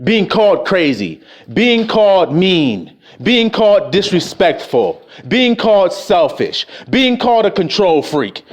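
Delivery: normal talking voice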